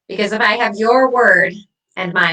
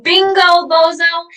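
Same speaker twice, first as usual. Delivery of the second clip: gloating; 8 semitones higher, 4 dB louder than usual. Acted. Gloating at her ex.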